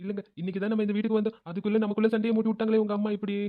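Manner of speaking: whining, sobbed complaint; hammed-up tearful accusation; fake crying. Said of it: "நீ அழாதம்மா". (போலியாக விசும்பி புகார்)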